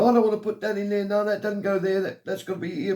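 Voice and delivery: high, fast, bossy nagging voice; fussy mum. Retold or imitated. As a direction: mimic a fussing mother giving orders. Son voicing fussy mum packing.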